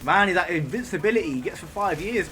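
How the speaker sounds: high, loud exasperated outburst; frustrated shout. Real, not acted. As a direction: vent loudly at the enemy.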